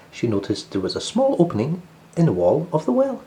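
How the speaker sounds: ordinary delivery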